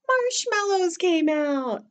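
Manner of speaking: high, drawn-out storytelling reveal; slow singsong surprise, high-pitched. A narrator's reading. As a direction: stretch it out high for the young listeners.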